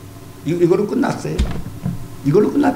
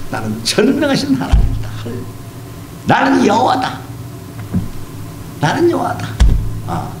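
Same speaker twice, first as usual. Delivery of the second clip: voicing God; 6 semitones higher than usual. Preacher voices God speaking.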